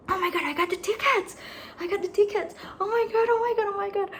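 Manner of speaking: high ecstatic shrieks; shrieking jackpot winner. Real, not acted. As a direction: scream with joy, loud and fast. Got the tickets.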